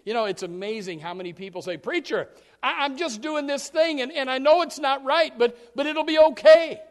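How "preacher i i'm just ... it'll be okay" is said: churchgoer excuse. Acted. Churchgoer excusing himself to preacher.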